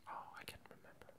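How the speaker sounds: normal talking voice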